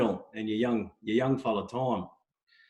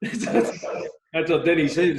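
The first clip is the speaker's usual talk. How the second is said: laughing; 5 semitones higher, 6 dB louder, much slower than usual. Real, not acted. Laughing at the host's joke.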